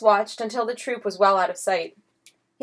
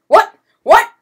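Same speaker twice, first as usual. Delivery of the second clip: sharp shouted questions; 12 semitones higher, 11 dB louder than usual. A narrator's reading. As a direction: snap it out in outrage. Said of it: "shouted Las Bambas".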